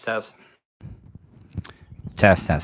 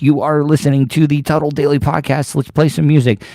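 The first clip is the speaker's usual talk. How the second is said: radio announcer; 5 semitones higher than usual. Acted. Podcast station announcement.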